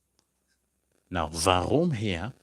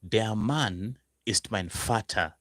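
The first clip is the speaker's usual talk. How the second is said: slow model sentence; slower than usual. Shown as a demonstration. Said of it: "Then you could say".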